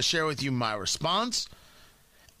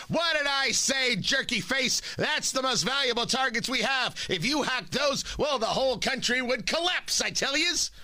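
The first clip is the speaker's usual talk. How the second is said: high-pitched, worked-up presidential scolding; Joe Biden; 8 semitones higher than usual. Acted. President berating the Russian over the list, Joe Biden impression. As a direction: play an exasperated old politician, voice pitched up. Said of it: "That's Joe Biden".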